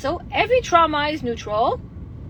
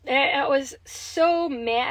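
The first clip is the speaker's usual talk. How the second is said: normal talking voice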